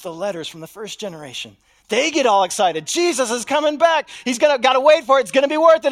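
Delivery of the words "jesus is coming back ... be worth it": higher, louder, fervent promise; rally the crowd with the promise; eager proclaiming. Acted. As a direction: church shouting the good news. Second generation acted, excited.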